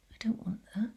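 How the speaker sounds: breathy unvoiced aside to herself; whispered aside. Real, not acted. Speaker fumbling with screen share, whispering to herself. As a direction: whisper it under your breath, annoyed.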